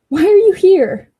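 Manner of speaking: annoyed call-out. Real, not acted. Annoyed at Lori showing up.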